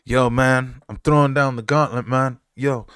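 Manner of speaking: lightly acted hype-man boast; Flackus's challenge. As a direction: throw it down like a hyped-up challenger. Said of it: "Flackus was like".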